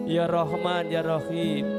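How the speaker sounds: usual speaking voice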